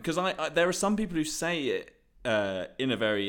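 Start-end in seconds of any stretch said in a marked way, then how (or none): none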